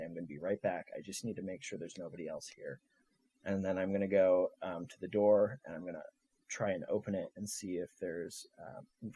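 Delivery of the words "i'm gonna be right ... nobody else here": soft reassuring. Acted. Leans in close to mother.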